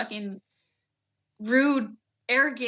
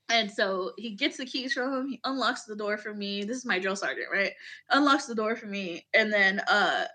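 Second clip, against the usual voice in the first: usual speaking voice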